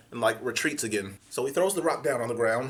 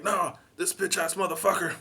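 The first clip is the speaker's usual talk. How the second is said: high dismissive snarl; defiant thug; 6 semitones higher than usual. Retold or imitated. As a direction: scoff like a street tough. Homeless man retorts.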